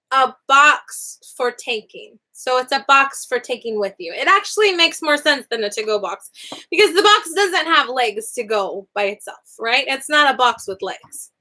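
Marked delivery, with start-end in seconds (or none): none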